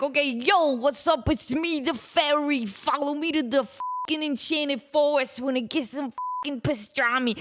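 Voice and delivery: casual, cocky fairy; a lightly voiced rude fairy; street-tough fairy. Shown as a demonstration. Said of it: "I'd be like".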